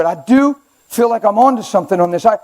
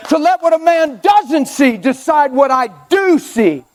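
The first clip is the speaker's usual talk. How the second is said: normal talking voice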